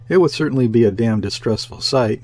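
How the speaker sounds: ordinary delivery, nothing marked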